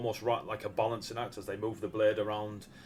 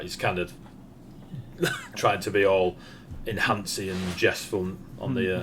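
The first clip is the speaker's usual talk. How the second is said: usual speaking voice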